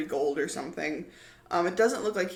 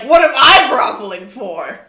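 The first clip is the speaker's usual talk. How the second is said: high and loud, offended; fairy scoffs at Micah, high and loud; indignant fairy; 7 semitones higher, 16 dB louder than usual. Acted. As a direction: scoff it out much louder. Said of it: "and he's like".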